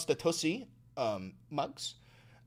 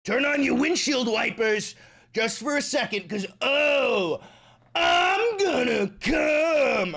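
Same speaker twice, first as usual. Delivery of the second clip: high, loud, drawn-out mock moan; hammed-up climax cry; 8 semitones higher, 6 dB louder, slower than usual. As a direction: overdo a ecstatic cry. Mock orgasm over coffee.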